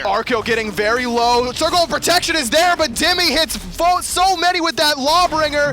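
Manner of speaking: high, loud esports hype; full-force play-by-play. Real, not acted. Caster calling a team fight to viewers, loud excitement. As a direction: shout the team fight as it explodes.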